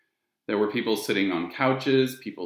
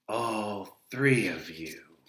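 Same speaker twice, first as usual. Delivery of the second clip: slow, doubtful; Charon, sceptical; much slower than usual. A narrator's reading. Ferryman doubting the children, slow and sceptical. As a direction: raise an eyebrow in voice. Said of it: "He had a strange sound to him".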